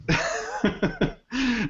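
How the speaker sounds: a burst of high-pitched laughter. Real, not acted. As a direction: laugh out at the quote, genuinely. Laughing at his own quote.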